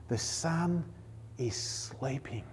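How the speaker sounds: whispered, slowing on sleeping; soft whisper about the sleeping Son; hushed. Acted. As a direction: hush it like a child is sleeping nearby. Hushed description of sleeping Jesus.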